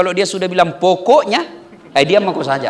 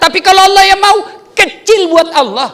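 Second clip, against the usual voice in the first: preaching peak; 9 semitones higher, 5 dB louder than usual. Acted. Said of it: "preaching peak".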